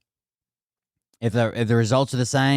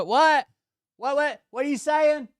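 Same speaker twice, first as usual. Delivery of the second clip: very high-pitched mocking questions; confused wife; 14 semitones higher than usual. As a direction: squeak it like she cannot hear. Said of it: "She would go".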